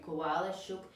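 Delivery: plain reading voice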